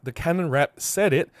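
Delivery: ordinary talk, nothing marked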